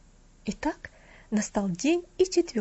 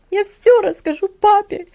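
inconsolable crying; 9 semitones higher, 13 dB louder than usual. A narrator's reading. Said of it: "безутешно плакала она".

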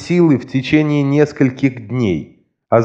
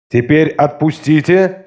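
commanding; 4 semitones higher than usual. A narrator's reading. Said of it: "скомандовал смотритель".

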